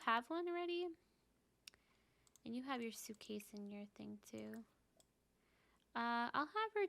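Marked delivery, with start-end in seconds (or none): 2.4-4.5 soft unvoiced whisper. Real, not acted. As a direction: hush it like a secret aside. Whispering to the game character.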